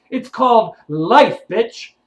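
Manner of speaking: ordinary talk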